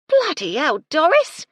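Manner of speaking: yelling. A narrator's reading. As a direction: scream at the clumsy girl. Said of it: "Gertie yelled".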